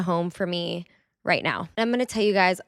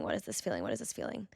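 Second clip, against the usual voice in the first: "What is this feeling?" asked much softer and lower, to herself; quiet inner questioning; 10 semitones lower, 13 dB quieter, faster than usual. Retold or imitated. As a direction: murmur the question inward as if searching. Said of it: "So I sat there in bed and I was like".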